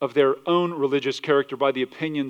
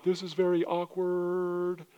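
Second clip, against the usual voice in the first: awkward bystander; 4 semitones higher, 7 dB quieter than usual. Retold or imitated.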